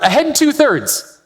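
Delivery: high, slow called-out command; yelled order. Retold or imitated. Captain to his crew on the submarine, slow yelled order. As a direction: bark the order across the control room. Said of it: "So David yells out".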